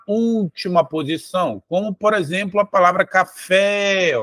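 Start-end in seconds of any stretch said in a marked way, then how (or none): 3.1-4.1 "café" with the final "fé" held long; stress on final syllable. Shown as a demonstration. Teacher showing students an oxytone, "café" with long stressed "fé". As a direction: lean on and stretch the "fé" of "café". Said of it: "A sílaba mais forte está no fé".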